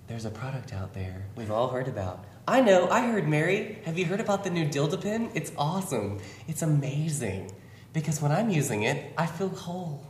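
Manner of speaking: ad voice. Acted. Ad parody voice.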